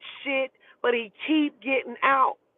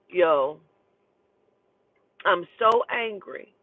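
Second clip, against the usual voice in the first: normal talking voice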